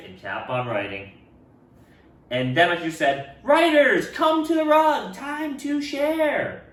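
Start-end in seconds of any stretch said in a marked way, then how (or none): 3.4-6.6 high, loud teacher call fading at the end; calling the class. Acted. Teacher calling writers to the rug, high and loud. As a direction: sing it out to the class.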